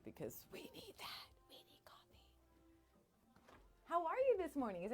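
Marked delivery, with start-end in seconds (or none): 0.0-2.2 breathy stage whisper, almost silent; playful whisper. Acted. Radio host whispering to viewers about coffee, playful hush. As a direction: hush it like a secret to the listeners.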